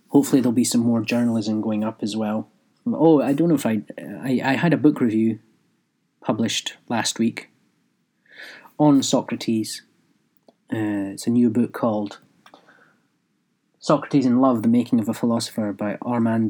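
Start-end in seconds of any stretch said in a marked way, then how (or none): none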